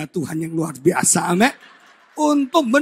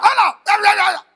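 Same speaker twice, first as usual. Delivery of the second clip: loud, high rapid-fire hellos; high-toned choleric man; 12 semitones higher, 12 dB louder, much faster than usual. Acted. Preacher imitates a choleric man, loud high-pitched hellos. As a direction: shout it way up high, hammed-up. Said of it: "tinggi nada".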